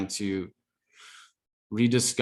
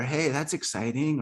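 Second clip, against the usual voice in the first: heart's hustler voice; 5 semitones higher than usual. Retold or imitated. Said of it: "a weird criminal-esque accent".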